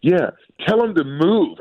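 high, slow mock child voice; imitating little daughter. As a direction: egg them on like a small kid. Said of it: "She's like".

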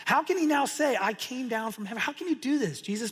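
high-pitched incredulous challenge; mock outrage, jumping up in pitch; acted uproar. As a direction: demand an answer like an affronted crowd. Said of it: "They're all in a roar, an uproar".

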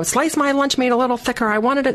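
high, whiny mock complaint; fussy customer. Shopper at the grocery deli counter, mock fussy.

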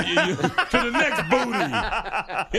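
slow, chuckling jab; giggling mockery of a friend; laughing tease. Real, not acted. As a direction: tease him through your laughter. Teasing co-host about phone.

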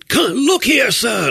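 high shouted call. Pretend. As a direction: shout after them. Calling after fleeing teens.

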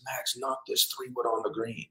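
secretive, intent pep talk; hushed intensity. Retold or imitated. Dad intensely confiding belief in son.

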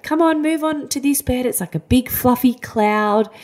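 high, sing-song coaxing voice; coaxing nurse. Retold or imitated. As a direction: coax a scared toddler onto the bed, sweetly. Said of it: "they were like".

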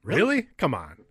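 sharp, high incredulous "really"; surprised. Real, not acted. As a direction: leap up in disbelief. Disbelief about vacuum headlights.

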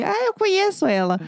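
mock child's high-pitched boast; very loud child. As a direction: pipe it up high, imitating a little kid. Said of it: "Tipo, muito alta". (imitando menina falando alto, voz bem aguda)

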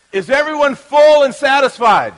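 shouting out. Real, not acted. Shouting question to the crowd.

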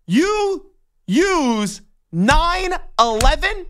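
"you use nine eleven" slowly, each word drawn out in disbelief. Shown as a demonstration. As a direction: stretch out "nine eleven" as if you can't believe it. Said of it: "are you kidding me?".

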